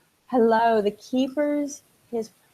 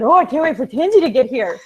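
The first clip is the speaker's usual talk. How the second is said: quick, louder burst of acted anticipation; reenacted eagerness; 3 semitones higher, 7 dB louder, faster than usual. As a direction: replay your impatient excitement in a rush. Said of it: "let me reenact what I did".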